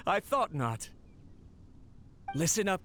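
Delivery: ordinary talk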